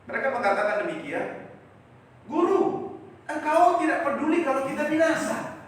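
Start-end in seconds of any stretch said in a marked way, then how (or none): 2.3-5.5 high, loud desperate plea to the teacher; loud cry. Retold or imitated. Frightened disciples shout at Jesus, loud and high. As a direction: cry out over the storm. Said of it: "dengan nada suara yang keras".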